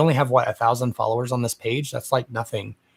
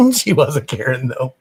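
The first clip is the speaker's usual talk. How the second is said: giggling through the words; laughing; 5 dB louder than usual. Real, not acted. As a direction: laugh as you say it. Laughing at herself.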